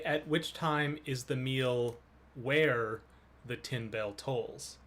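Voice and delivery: slow and deliberate; slow, careful. Shown as a demonstration. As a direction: lay out each word slowly. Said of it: "so it would work if you say".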